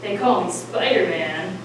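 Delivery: low, slow superhero line; deep hero voice. Acted. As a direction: announce it like a hero.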